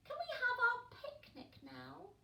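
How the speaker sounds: tiny impatient chick; high little penguin. Acted. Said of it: "said Pog".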